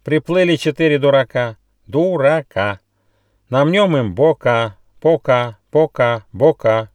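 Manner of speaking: mock threat, pounded out rhythmically; jeering kookaburra. A narrator's reading. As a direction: taunt the newcomers in squawky rhyme.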